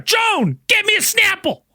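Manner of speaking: mock yell to a wife off camera; Tom Biscardi. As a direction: call out bossily in a high-pitched imitation. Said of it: "yelling at his wife off camera".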